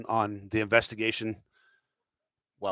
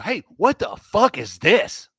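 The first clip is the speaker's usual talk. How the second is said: mock outraged newsroom; 8 semitones higher, 6 dB louder, slower than usual. Newsroom discovering the cover-up.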